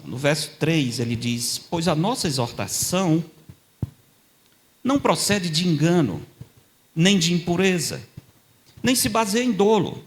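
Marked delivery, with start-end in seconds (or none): 1.6-10.0 high, loud preaching of scripture; preaching at full force. Acted. Preacher declaring the verse to the congregation with full force. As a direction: proclaim the verse with rising force.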